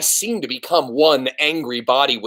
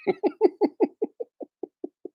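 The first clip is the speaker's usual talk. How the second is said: emotional laugh; 5 dB quieter than usual. Real, not acted. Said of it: "I'm so proud of Despero".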